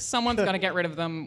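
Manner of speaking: usual speaking voice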